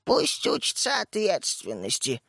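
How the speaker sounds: slow mocking squeak, imitating someone; slow, thin-voiced mockery. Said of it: "сказал тоненьким голоском".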